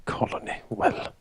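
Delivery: low, grumbled aside while stalking off; mumbling. Acted. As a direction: mumble indignantly to yourself. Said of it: "stalking off, mumbling".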